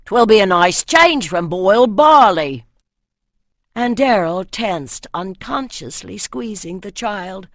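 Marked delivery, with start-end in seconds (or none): 0.1-2.6 put-on northern accent. A narrator's reading. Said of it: "answered the northerner".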